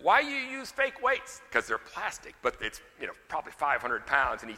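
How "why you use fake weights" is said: high, slow mock-Polish accent; high-pitched Polish-accented question. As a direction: imitate a Polish janitor, pitched way up. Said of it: "in his Polish accent".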